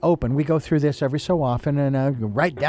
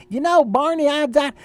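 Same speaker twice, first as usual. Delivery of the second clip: Andy Griffith; 11 semitones higher, 3 dB louder than usual. Retold or imitated. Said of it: "how Andy Griffith in the Andy Griffith show".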